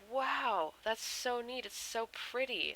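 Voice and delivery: high, wowed friend; gushing admiration. Retold or imitated. Friend gushes over the tattoo.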